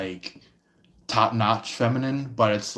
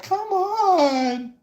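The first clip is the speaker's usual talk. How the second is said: much higher, pleading tease; flirty come-on voice. Retold or imitated. Tarot reader mimics someone begging for fun. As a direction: coax them with a high sing-song come-on. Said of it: "they're like".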